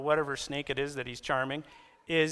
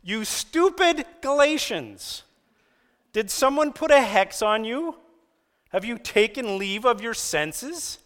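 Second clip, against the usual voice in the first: high, slow, mock-outraged rebuke; preacher reading the Message version as a shocked high scold; exasperated scolding; 6 semitones higher, 6 dB louder than usual. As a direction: let them have it, half amused. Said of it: "would say it like this".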